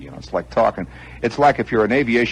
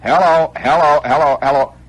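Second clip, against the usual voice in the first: loud, raised test calls; mic test call; 8 semitones higher, 8 dB louder than usual. Retold or imitated. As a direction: call out into a dead microphone. Acting out a microphone test.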